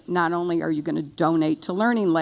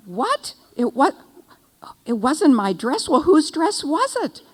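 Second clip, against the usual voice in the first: surprised disbelief; 9 semitones higher, 4 dB louder than usual. Retold or imitated. Shocked at daughter's news.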